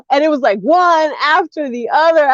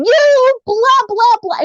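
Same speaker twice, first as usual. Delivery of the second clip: retold road-rage outburst, pitched way up; 11 semitones higher than usual. Daughter cursing drivers in front of her mother, road rage. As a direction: mimic yelling at another driver. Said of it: "My road rage came out".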